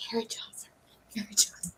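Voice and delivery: breathy whisper fading into a murmur; whispered to herself. Retold or imitated. Replaying the name in her head, repeated whisper. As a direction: mouth the name over, barely voiced, thinking hard.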